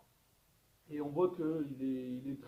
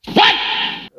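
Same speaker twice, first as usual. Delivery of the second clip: loud what; 18 dB louder than usual. Real, not acted. Reacts to his own mistake.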